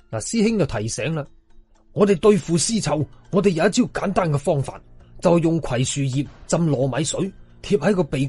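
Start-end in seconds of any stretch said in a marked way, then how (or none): none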